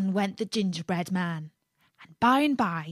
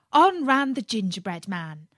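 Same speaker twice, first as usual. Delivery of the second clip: usual speaking voice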